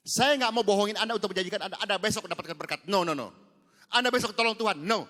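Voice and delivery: loud, high, slowed preaching; preaching at full force. Acted. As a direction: press the point home to the congregation. Preacher warning the congregation.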